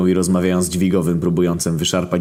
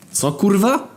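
disbelief; 12 semitones higher, slower than usual. Acted. Narrator's disbelieving reply.

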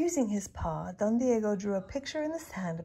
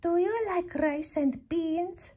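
higher, slow sing-song question; high dog voice; 9 semitones higher, slower than usual. A narrator's reading. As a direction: ask sweetly and slowly. Chihuahua asks.

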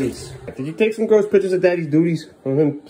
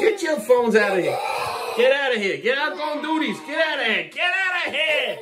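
comic shooing; 12 semitones higher than usual. Pretend. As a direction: holler it high, stretching the last words. Shooing kid and phone from bathroom.

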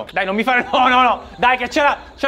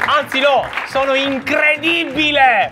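triumphant; 6 semitones higher, 3 dB louder, slower than usual. Real, not acted. Host boasts after winning.

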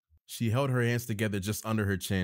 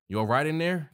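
raised, higher voice calling into a room; 8 semitones higher, 7 dB louder than usual. A narrator's reading. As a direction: call in to check on someone. Chris calls in from behind.

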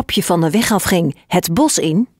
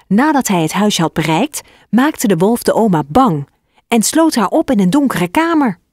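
ordinary delivery, nothing marked

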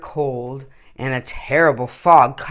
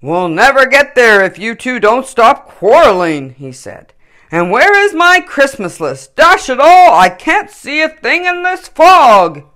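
higher, louder, flustered Santa scolding; upset Santa; 8 semitones higher, 10 dB louder than usual. A narrator's reading. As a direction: huff and grumble as Santa. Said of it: "Even Santa got upset".

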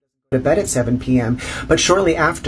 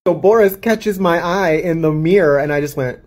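ordinary delivery

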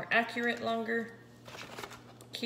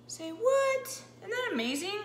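delighted surprise; 10 semitones higher than usual. Real, not acted. Showing off keto cupcakes.

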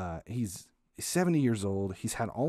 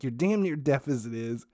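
higher, louder mock mom, bossy; 4 semitones higher, 3 dB louder than usual. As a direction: lay down the law as mom, pitched up. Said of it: "she's like".

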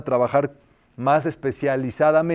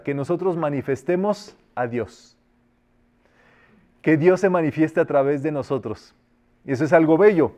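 ordinary delivery

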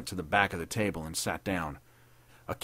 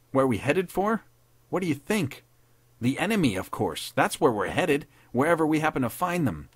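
higher, exasperated comeback; scornful retort; 7 semitones higher, 4 dB louder than usual. Acted. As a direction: throw the silly question back. Captain snaps back at obvious question.

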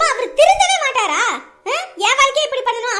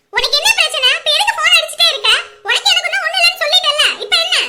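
loud, angry and higher, cutting him off; angry on the phone; 4 semitones higher, 6 dB louder than usual. Acted. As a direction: tell him off sharply over the phone. Angry girlfriend snaps on phone. (கோபமாக கத்துதல்)

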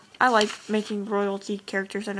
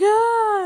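a high, loud little yelp of fright; mock startled yelp; 12 semitones higher, 5 dB louder than usual. Vlogger voicing a comic character's freak-out, high yelp. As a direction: squeak like a comic character in shock. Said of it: "And he's like".

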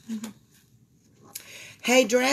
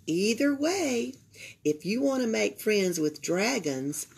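ordinary delivery, nothing marked

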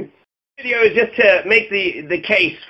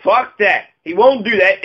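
ordinary delivery

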